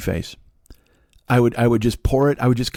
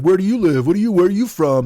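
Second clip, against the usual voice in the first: raised, higher-pitched mock challenge; retold drunken yelling; 7 semitones higher, 4 dB louder than usual. Imitating a drunk guy confronting a customer, loud and challenging. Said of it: "he's all drunk".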